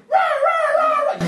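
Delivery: very high screechy mock squabbling; cartoonish high-pitched "rah" yells; kids yelling. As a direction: imitate little demons shrieking at each other. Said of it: "yelling at each other".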